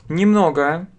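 normal talking voice

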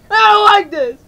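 loud, high outburst of alarm; panicked shout. Acted. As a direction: yell it out in panic.

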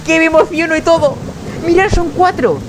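overjoyed at the newborn pups; squealing with delight; excited. Real, not acted. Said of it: "Voy a emocionarme".

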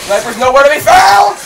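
loud, high call rising in exasperation; frustrated shout. Real, not acted. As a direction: shout that the target has vanished. Searching for sniper in game.

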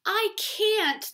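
a high, loud burst of refusal; protesting fish. Acted. Fish protests the octopus's advice.

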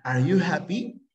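"Are you happy?" with the voice climbing on "you"; rising question tune. Shown as a demonstration. Teacher showing students how English questions sound: sung rising tune. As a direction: sing the question upward on "you". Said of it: "cuando tú preguntas, como que cantas".

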